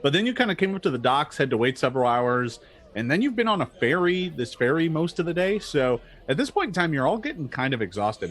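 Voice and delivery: ordinary talk, nothing marked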